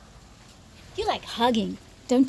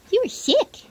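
higher and louder pet voice; louder high pet talk; 14 semitones higher, 9 dB louder than usual. Real, not acted. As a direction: say it again, squealing to the dog.

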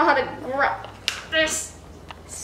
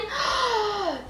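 mock gasp; 6 semitones higher, 6 dB quieter than usual. Reacting to Calvin Klein joke.

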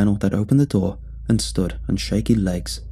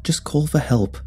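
higher, begging tone; pleading; 6 semitones higher than usual. A narrator's reading. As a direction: plead for help urgently. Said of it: "Duck Shoes pleaded".

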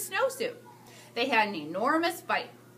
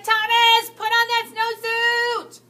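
high, loud, slow singsong shriek of a fed-up mother; exasperated yell; 10 semitones higher, 9 dB louder, much slower than usual. Acted. Mother jumping up and down, shrieking at Thomas. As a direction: stretch it out like a frantic mom at the end of her rope. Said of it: "The teacher jumped up and down and said".

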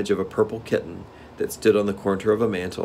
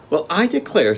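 surprised joy; 10 semitones higher, 4 dB louder than usual. A narrator's reading. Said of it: "partly in surprise and partly in joy".

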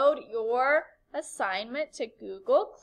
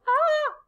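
short high mock shriek of surprise; surprised shriek; 6 dB louder than usual. Shown as a demonstration. Teacher demonstrates surprise for an exclamation point, high shriek. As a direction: jump and squeal.

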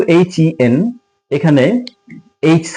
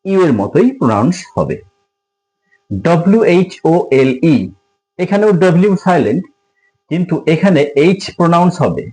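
normal talking voice

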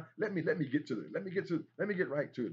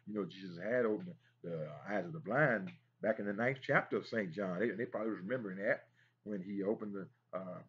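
ordinary talk, nothing marked

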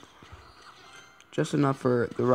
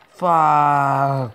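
frustrated; 7 dB louder than usual. Real, not acted. Frustration during live attack.

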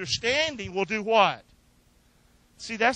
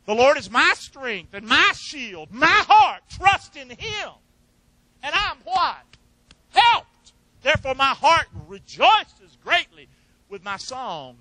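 high, loud preaching cry building on heart; fervent preaching; 7 semitones higher, 9 dB louder, slower than usual. Acted. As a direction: proclaim it like a revival preacher. Preaching the psalm at full force.

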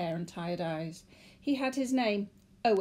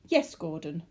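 usual speaking voice

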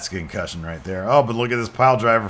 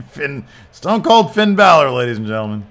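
announcer intro; 4 semitones higher, 9 dB louder than usual. Acted. Player introducing his wrestler to the crowd, announcer call. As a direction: drag out the name like a ring announcer.

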